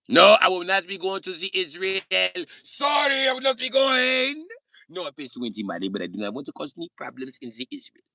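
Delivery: high, loud hammed-up German refusal; mock German accent. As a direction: refuse loudly as a cartoon German. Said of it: "Why are you doing the German accent".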